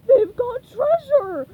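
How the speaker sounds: high, loud slow yelp. Acted. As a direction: yelp it out in panic. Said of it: "the pirate yelped".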